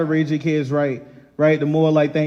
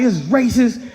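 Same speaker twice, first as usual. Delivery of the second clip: mock livid rant; 7 semitones higher than usual. Dad ranting at the trampoline park employee, mock livid. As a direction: play the outrage big and ridiculous. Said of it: "I'm livid".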